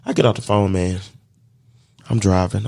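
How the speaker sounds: ordinary talk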